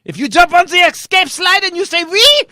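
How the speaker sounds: a strict German flight attendant shouting commands. Acted. German stewardess shouts orders at passengers, German accent. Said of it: "She, she forgot to tell them".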